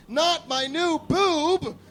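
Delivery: slow, high, laughing wail; hammed-up howl of dismay; wailing protest. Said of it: "Hectorina screamed".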